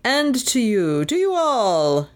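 a hearty clergyman welcoming his flock; jolly vicar. A narrator's reading. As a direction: greet them like a beaming vicar. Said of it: "the vicar replied".

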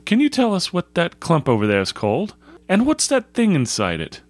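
put-on high-pitched, courteous young scout; polite schoolboy. Polite Algernon's voice.

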